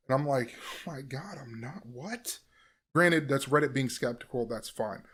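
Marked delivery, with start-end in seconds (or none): 0.6-2.4 near-whispered exasperation; quiet stunned protest; hushed disbelief. Retold or imitated. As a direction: mutter it in disbelief. Incredulous reply to Reddit, very quiet.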